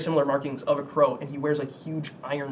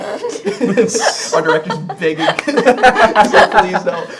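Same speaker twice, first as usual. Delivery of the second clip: loud, high words through laughter; laughing; 6 semitones higher, 15 dB louder, much slower than usual. Real, not acted. Joking to players about cancellation, loud laughing. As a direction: crack up while talking.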